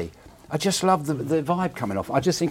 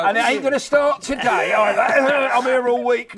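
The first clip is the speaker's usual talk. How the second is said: joking outburst; 7 semitones higher, 4 dB louder than usual. Real, not acted. Joking self-deprecation on the show.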